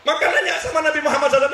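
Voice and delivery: usual speaking voice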